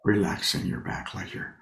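unhurried, leave long pauses between short phrases; guided meditation. Real, not acted. Teacher settles sitters into posture and breath, guided meditation.

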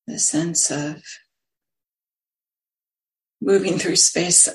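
unhurried meditation guidance with long pauses; lingering on words, leaving long silences between phrases; guided meditation. Acted. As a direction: guide listeners gently into stillness, phrase by phrase.

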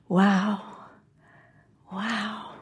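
soft wow, fading further; hushed retold wonder; quiet amazement. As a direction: marvel under your breath. Retells quiet amazement at herself.

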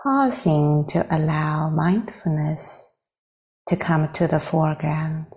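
unhurried phrases with long silences between; guided meditation. Real, not acted. Teacher leading meditators into arriving with the breath, guided meditation. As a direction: guide gently, leaving space after each phrase.

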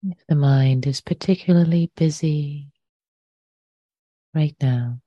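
unhurried meditation guidance with long pauses between phrases; guided meditation. Real, not acted. Teacher inviting listeners to settle into the body, guided meditation. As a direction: lead them gently into the breath, leaving lots of silence.

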